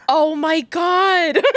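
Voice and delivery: high, stretched-out gush with laughter; overjoyed fan reaction. Retold or imitated. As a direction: re-enact a thrilled fangirl moment. Acting out delighted fan reaction.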